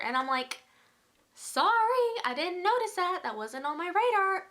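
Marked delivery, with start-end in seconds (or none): 1.3-4.5 sarcastic. Retold or imitated. Sarcastic reply to friends.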